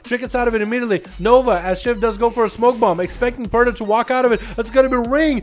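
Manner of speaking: fast-running esports commentary building to a big cry; live play-by-play. Real, not acted. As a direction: call the match live, rising to the climax.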